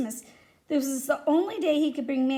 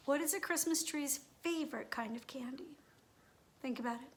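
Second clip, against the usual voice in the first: whispered riddle; 13 dB quieter than usual. Real, not acted. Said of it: "unvoiced whisper".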